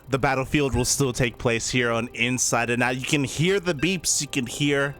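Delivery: hyped play-by-play caster voice; esports caster. Acted. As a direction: announce it like a live caster. Casts the next series.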